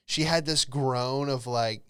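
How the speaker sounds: ordinary delivery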